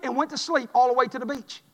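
ordinary delivery

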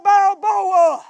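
calling a name from outside; hollering a name. Retold or imitated. Someone outside hollering for Rocky, loud call. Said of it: "he hollered".

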